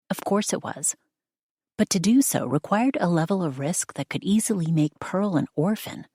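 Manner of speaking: usual speaking voice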